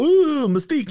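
high, loud "ooh" of fake awe; exaggerated swooning over a character; mock fan excitement. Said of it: "people will go".

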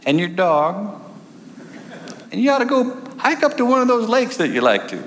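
prim lady, high and sing-song; falsetto church lady. Retold or imitated. Woman advises hiking trip.

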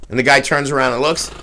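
normal talking voice

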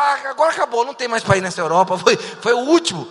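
loud, high comic panic; mock despair. Player who has run out of European countries, loud mock despair. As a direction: exclaim as if all options just ran out.

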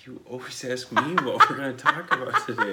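chuckling out the words in amusement; laughing. Real, not acted. Husband laughing as wife asks today's topic. Laughing at his wife's question.

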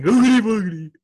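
high, loud mock-spooky chant; spooky ghost cry. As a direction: ham up the spooky catchphrase. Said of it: "why we keep randomly saying".

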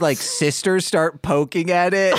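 usual speaking voice

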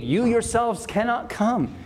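"You yourselves cannot come", high and emphatic, falling at the end. Shown as a demonstration. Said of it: "It emphasizes our total need".

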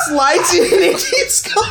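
high, breaking into laughter; words squeezed out between laughs; laughing. Real, not acted. Recounting the calm guy's swap, laughing hard. He carries on unfazed.

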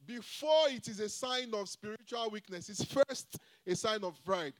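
ordinary talk, nothing marked

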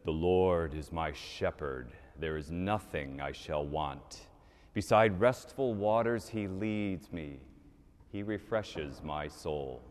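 slow, stately, measured psalm recitation; grand, unhurried stage rendition; actor's beautiful recital. Acted. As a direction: recite it like a great actor, drawn out. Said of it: "in his beautiful voice he says".

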